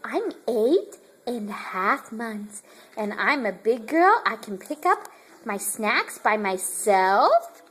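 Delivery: a light baby voice put on for the child; voicing the baby.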